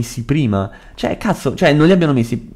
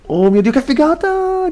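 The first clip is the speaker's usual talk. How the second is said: esaltazione. Real, not acted. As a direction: squeal acuto di meraviglia. Esulta per la scoperta nel gioco. (esaltato, stupore acutissimo)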